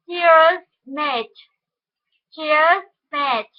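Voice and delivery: normal talking voice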